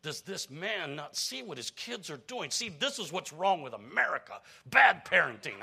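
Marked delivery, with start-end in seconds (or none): 2.5-5.6 rising indignant rant, getting louder; seething muttering. Acted. Stockbroker fuming inwardly at the careless father, angry. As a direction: let the inner grumbling boil up. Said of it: "He's just seething on the inside".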